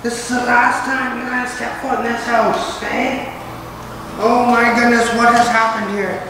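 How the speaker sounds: high, hushed talk to the dog; pet talk to the dog. Real, not acted. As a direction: coo to the dog in the empty house. Talking to the dog in the empty house.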